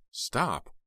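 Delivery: high, sharp cry; high sharp stop. A narrator's reading. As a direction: stop him cold. Heather pulling away.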